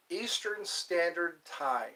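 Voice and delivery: slow, measured Eastern Standard Time; said slowly. Real, not acted. Streamer giving viewers the time zone, said slowly. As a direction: take each word slowly and carefully. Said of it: "I have to say that slowly".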